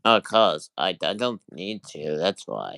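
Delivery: low droning voice. Pretend. Deep droning reply.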